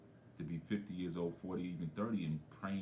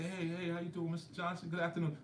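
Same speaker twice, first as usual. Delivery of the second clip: friendly greeting; 10 semitones higher than usual. Retold or imitated. Young self greets old man.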